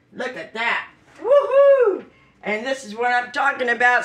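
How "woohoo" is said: high, loud joyful woohoo; excited whoop. Real, not acted. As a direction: cheer it out like you nailed it. Cheering over avocado.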